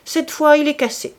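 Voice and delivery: put-on old man giving up on the broken cross; exclaiming. A narrator's reading. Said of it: "s'écria-t-il". (s'exclamer fort, aigu, agacé)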